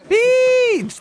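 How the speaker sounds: excited call. Real, not acted. Greeting a subscriber by name.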